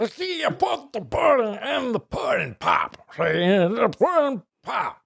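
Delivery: high, garbled comic celebrity impression; Cosby. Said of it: "You sound like Cosby if he had a stroke".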